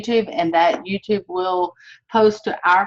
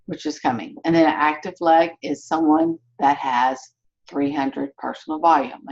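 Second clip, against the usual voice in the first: usual speaking voice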